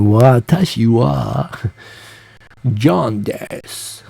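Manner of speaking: slow, drawn-out American student speaking Japanese; mock American Gaijin accent in Japanese, very slow; exaggerated American accent in Japanese. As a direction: stretch every vowel like a clueless American student. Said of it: "with the exaggerated diphthongy English".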